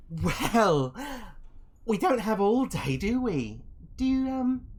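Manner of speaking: high, loud, toying villainess voice; skull-masked woman. Acted. Masked woman presses a guest for an answer to her lord, high and mocking. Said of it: "the woman here in the skull mask".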